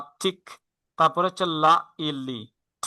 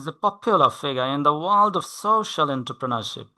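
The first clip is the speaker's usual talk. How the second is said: slow model reading; much slower than usual. Shown as a demonstration. Tutor reading the sentence slowly for the student to repeat. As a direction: read it very slowly, word by word. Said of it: "এই সেন্টেন্সটা বলেন তো".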